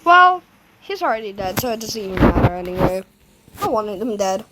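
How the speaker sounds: ordinary talk, nothing marked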